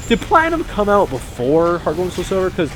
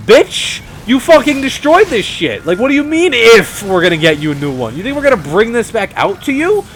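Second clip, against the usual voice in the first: higher, louder mock outrage; mock indignant retort; 8 semitones higher, 5 dB louder than usual. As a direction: fire back in hammed-up disbelief. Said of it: "And it's like".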